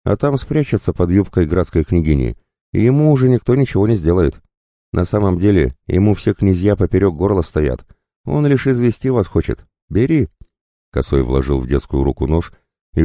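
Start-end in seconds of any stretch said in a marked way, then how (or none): 0.0-10.3 higher-pitched, lively bandit voice; pushy tempter, pitched up; goading bandit. Acted. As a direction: egg the boy on in a higher voice. Voices the bandit egging on the boy.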